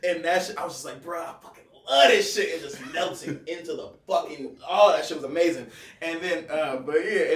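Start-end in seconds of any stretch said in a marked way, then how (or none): 1.0-2.5 excited retold exclamation. Retold trip delight.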